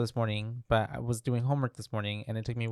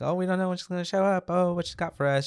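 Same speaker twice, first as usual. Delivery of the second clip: higher-pitched, quick, eager chatter; playfully excited, pitched up and hurried; mock excitement; 7 semitones higher, faster than usual. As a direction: imitate a family buzzing about a visitor. Voicing family awaiting the fun aunt.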